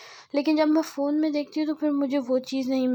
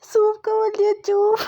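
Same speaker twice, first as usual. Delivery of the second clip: high, louder words through giggles; laughing; 9 semitones higher, 6 dB louder than usual. Real, not acted. Vlogger retells her soup slip, laughing through it. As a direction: barely get it out for laughing. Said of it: "इतना हंसी हूँ ना".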